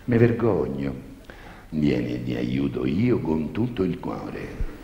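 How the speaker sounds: low, slow priest voice; confessor priest voice. Acted. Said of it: "c'è la famosa confessione".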